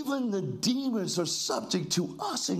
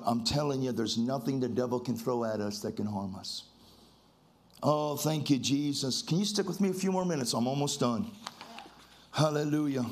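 hushed worship; 7 semitones lower than usual. Real, not acted. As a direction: sink low and speak it to the Lord. Preacher calms into low worship.

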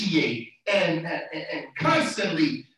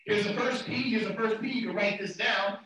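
ordinary talk, nothing marked

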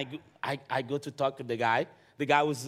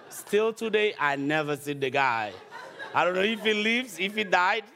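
laughing; 8 semitones higher than usual. Real, not acted. Laughing at the ending.

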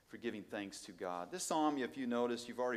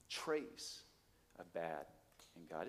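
ordinary delivery

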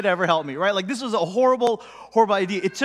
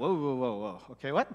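quiet, lower stunned whoa; alarmed hush; 7 semitones lower, 9 dB quieter than usual. Retold or imitated. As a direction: back off in hushed alarm. Disciples alarmed, hushed.

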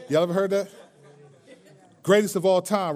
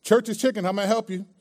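cashier greeting; 5 semitones higher, 3 dB louder than usual. Retold or imitated. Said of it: "It'd go like this".

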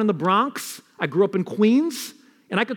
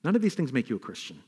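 quick soft restatement; 8 semitones lower, 7 dB quieter, faster than usual. Acted.

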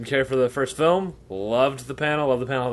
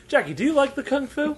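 high-pitched simple question; mock interpreter; 12 semitones higher than usual. Interpreter asks Jackie about kung fu, high mock voice. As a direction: imitate an interpreter carefully repeating, pitched way up.

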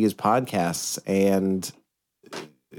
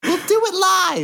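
much higher, mock anchor losing it on air; high put-on meltdown voice; 3 dB louder than usual. Cohost mocking a studio meltdown, in a high put-on voice. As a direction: squeal it like a host melting down live.

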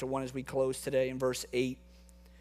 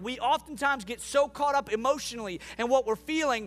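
preaching at full force; 4 semitones higher than usual. Real, not acted. Pastor assuring the congregation God never leaves, impassioned preaching.

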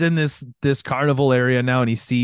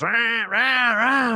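high-pitched vocal chainsaw revs; mouth-made engine whine; imitated chainsaw revving; 9 semitones higher, much slower than usual. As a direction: mimic a chainsaw revving in bursts. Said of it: "Fucking Leatherface with chainsaws".

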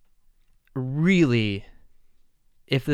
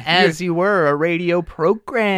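radio host voice; 4 semitones higher, 5 dB louder than usual. Acted. Mock radio host intro.

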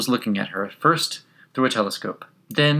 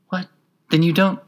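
small, high, startled question; little voice; 9 semitones higher, 4 dB louder than usual. A narrator's reading. Gnat starting to question Alice, little voice. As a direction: pipe up in a small voice. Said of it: "the little voice began".

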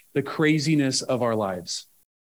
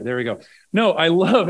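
usual speaking voice

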